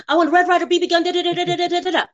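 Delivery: very high monotone rattle; imitated kid's blurted wish. Ralphie climbs back up to tell Santa, high rattled-off imitation. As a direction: reel it off high on one note like a kid.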